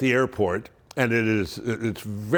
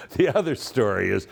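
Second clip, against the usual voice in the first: normal talking voice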